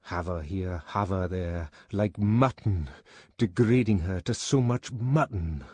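bitter, disgusted brooding; contained anger, rising on the insult; seething. A narrator's reading. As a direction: repeat it resentfully through gritted teeth. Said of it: "Bernard ground his teeth".

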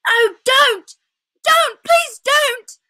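put-on high, loud, desperate boy's cries; shouted plea. A narrator's reading. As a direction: beg frantically for her to stop. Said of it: "shouted Edmund".

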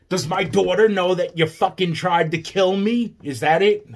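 loud, high, furious demand; angry outburst. Real, not acted. Father confronting people who tried to kill him, furious outburst. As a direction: raise the voice and demand an answer.

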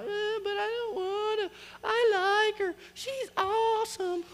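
very high mock whine; whiny lovesick kid. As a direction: play your younger self pleading in a squeaky whine. Acts out lovesick younger self objecting.

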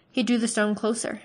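ordinary delivery

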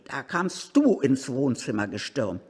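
usual speaking voice

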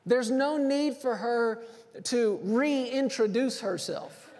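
normal talking voice

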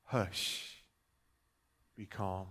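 very soft, low near-whisper; hushed command. Acted. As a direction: gently still the storm, barely voiced. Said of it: "only had to whisper".

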